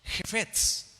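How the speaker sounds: "jefetz" with a throaty first sound and stress on the second E; Hebrew word shown. Shown as a demonstration. Teaching the congregation the Hebrew for delight, careful "jefetz". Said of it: "la palabra deleite en el hebreo".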